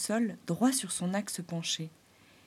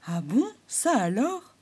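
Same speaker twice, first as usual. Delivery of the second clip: surprised exclaim; 4 semitones higher, 5 dB louder, slower than usual. Acted. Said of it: "s'exclama Chafouin".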